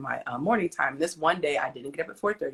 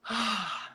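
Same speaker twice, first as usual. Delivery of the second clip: soft exhale of release; relieved sigh; 3 semitones higher, 7 dB quieter than usual. Acted. Believer relaxing into God's care, relieved sigh. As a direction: let it all go in a sigh.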